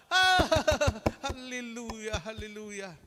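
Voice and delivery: high, loud mocking praise fading out; mock cheering. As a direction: ham up the crowd's delight, then let it die. Mocking crowd cheering swearing.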